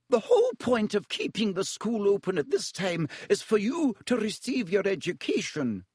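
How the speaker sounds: crisp disapproving governess; high, clipped stern teacher. A narrator's reading. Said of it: "she said sternly".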